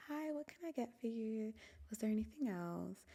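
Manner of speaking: high, chirpy customer service voice; McDonald's cashier greeting a customer, high and bright. Shown as a demonstration. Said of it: "the customer service voice".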